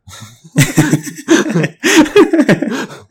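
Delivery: big loud high laugh, real; loud burst of laughter. Real, not acted. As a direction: crack up loudly at the punchline. Big laugh at own story.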